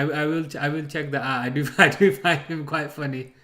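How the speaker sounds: ordinary delivery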